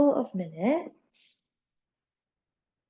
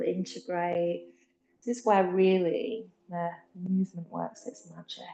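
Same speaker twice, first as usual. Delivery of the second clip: guided meditation. Acted. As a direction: ease them gently into stillness. Leads the rest as a meditation.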